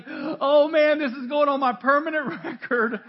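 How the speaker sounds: mock panic. Joker panics to pastor about permanent record, hammed-up dismay. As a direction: play the guy's mock horror big. Guy fearing pastor judgment.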